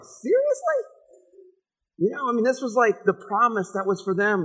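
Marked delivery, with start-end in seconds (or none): 0.0-0.8 much higher mock disbelief; incredulous seriously. Half of Sarah doubting, higher incredulous mock. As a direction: squeal it in disbelief. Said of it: "part of her's like".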